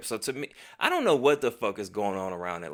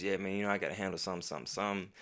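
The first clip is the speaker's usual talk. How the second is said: fast, softer mumbled excuse; lame excuse; 3 semitones lower, 7 dB quieter, much faster than usual. Retold or imitated. Brushing off the friend with a fake excuse, mumbled fast. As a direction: rush it out like a flimsy excuse. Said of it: "I make up some stupid ass excuse".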